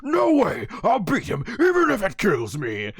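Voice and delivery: high, strained mock defiance; imitated defiant outburst; Vegeta. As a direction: refuse stubbornly in a hammed-up cartoon fighter voice. Acts out Vegeta's defiant reply.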